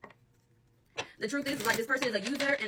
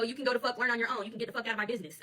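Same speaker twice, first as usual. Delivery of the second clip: normal talking voice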